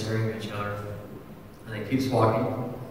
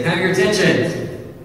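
higher, louder call for attention; raised call to a restaurant crowd; 8 semitones higher, 6 dB louder than usual. Retold or imitated. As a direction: grab the room's attention. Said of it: "I said".

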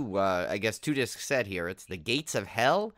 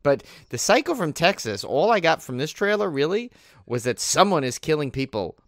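ordinary talk

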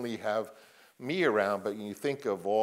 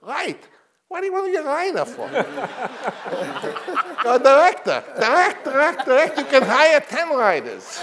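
much higher and louder, laughing through it; Sam Goldwyn impression; 14 dB louder than usual. Goldwyn scolding Coppola for writing, high loud laughing imitation. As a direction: hammer the old boss's scolding, cracking up. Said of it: "he talked like I'm gonna talk".

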